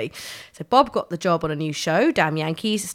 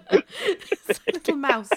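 laughing; 6 semitones higher than usual. Real, not acted. Joke about soft thud.

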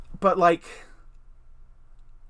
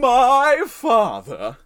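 loud, high, very slow British drawl; British Draco accent; 8 semitones higher, 7 dB louder, much slower than usual. Shown as a demonstration. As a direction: belt My father in a haughty British drawl. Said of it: "try the accent of that".

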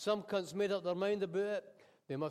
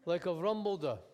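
ordinary delivery

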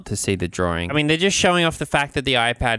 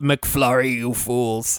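mock Gandalf; slower than usual. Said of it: "May Musk is like".

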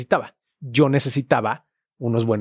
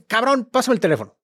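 higher, urgent retold demand; insistent demand; 8 semitones higher than usual. Cutting off friend's objection on the phone, insistent demand. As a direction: cut in impatiently, pitch jumping up.

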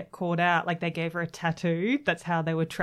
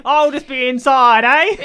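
slow, loud, broad Australian drawl; exaggerated Aussie; 5 semitones higher, 10 dB louder, slower than usual. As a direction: play a caricature Aussie, half laughing. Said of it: "the most Australian man on earth".

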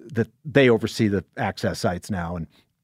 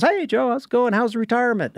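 put-on high, cheery hello from an old colleague; lightly voiced friendly woman greeting; cheery caller; 12 semitones higher than usual. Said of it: "she goes".

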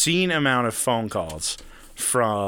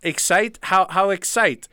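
higher, puzzled imitation of the caller; confused caller; 6 semitones higher, 4 dB louder, slower than usual. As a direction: play the baffled salesman. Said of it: "he's just like".

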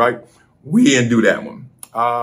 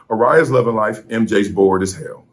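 normal talking voice

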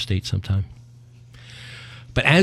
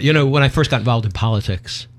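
ordinary talk

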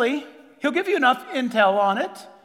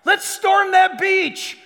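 high, loud battle shout; rally the troops with a shout; rallying cry; 9 semitones higher, 7 dB louder than usual. Acted. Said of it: "who said things like".

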